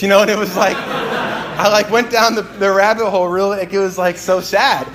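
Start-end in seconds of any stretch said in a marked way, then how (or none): none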